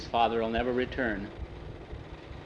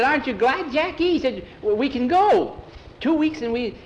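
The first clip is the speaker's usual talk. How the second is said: much higher, loud, bubbling over; overjoyed boy; 12 semitones higher, 5 dB louder than usual. Acted. Boy urging Jackie to be glad, high and thrilled. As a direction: gush with joy at the friend.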